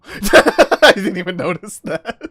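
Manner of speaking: laughing through the words; giggling as she talks. Real, not acted. Laughs at the cannon detail.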